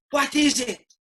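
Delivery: high, loud imitation of an affronted manager; mock indignant challenge. Manager confronting benched player after a rude gesture, mock indignant. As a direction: fire it back, pitched right up.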